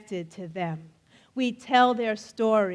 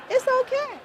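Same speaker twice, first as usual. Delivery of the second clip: much higher, in a put-on squeaky voice; mock reassurance; 13 semitones higher, faster than usual. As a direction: pipe it again like a cartoon. Repeated teasing reassurance.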